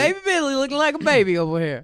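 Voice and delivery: high, loud fan gush; delighted gushing. Real, not acted. Fan gushing over actor on screen, high and delighted.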